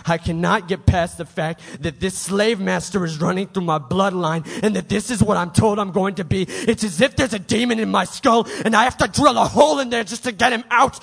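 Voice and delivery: normal talking voice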